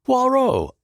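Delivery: high, loud glad cry; relieved shout of welcome; relieved exclamation. A narrator's reading. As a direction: call his name in sheer relief. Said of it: "I exclaimed with relief".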